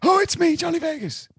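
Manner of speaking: high put-on Johnny Vegas buzzer. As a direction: squeak it out as a comic buzzer. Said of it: "mine's gonna be".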